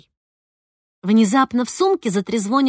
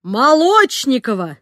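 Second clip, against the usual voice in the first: loud, slow angry call; teacher thundering a student's name; 2 semitones higher, 6 dB louder, slower than usual. A narrator's reading. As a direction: bellow her name to stop her. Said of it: "прогремела историчка".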